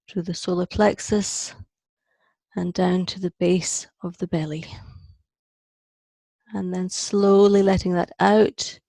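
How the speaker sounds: measured meditation voice with long pauses; guided meditation. Acted. Teacher leading a group down through the breath, guided meditation. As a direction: guide each breath in short, spaced-out phrases.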